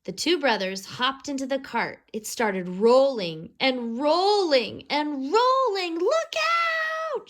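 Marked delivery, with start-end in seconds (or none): 6.0-7.3 sharp high warning cry; high, alarmed look out; alarmed shout. A narrator's reading. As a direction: yell it as the cart races. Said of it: "shouted Kevin".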